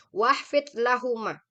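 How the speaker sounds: teacher recites Arabic verse slowly and loud; Quran recitation. Shown as a demonstration. Verse phrase repeated.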